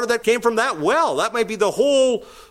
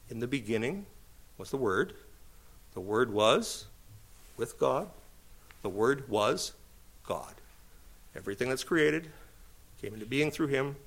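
solemn scripture reading; 6 semitones lower, 11 dB quieter than usual. Acted. Preacher quietly reciting the opening of John, hushed and solemn. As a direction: let each phrase of the verse land quietly. Reads John's prologue softly.

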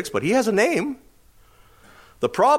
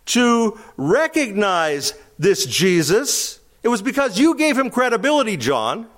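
high, slow, coaxing flattery; butter John up, leaning on "you"; flattering disciples; 10 semitones higher, 3 dB louder, slower than usual. Acted. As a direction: disciples pleading with John about his standing. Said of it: "In other words".

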